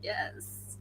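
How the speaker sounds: breathless whisper; tiny voiceless yes. Retold or imitated. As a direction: hush it like a secret triumph. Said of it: "I was like".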